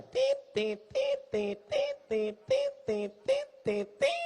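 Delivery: high, thin imitated heart-monitor beep; imitated flatlining monitor. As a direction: beep like a hospital machine. Imitating hospital monitor beeps.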